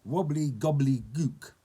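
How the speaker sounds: slow, loud incantation; hammed-up goblin spell; magic word. A narrator's reading. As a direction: draw out the magic word with relish. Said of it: "he said a magic word".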